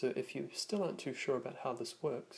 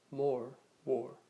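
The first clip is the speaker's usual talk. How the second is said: North American "more, war" with the r firmly sounded, louder; North American accent; 5 dB louder than usual. Shown as a demonstration. Teacher comparing North American pronunciation, loud American r in "more, war". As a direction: curl the r at the end of "more, war", American style. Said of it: "North American style accents".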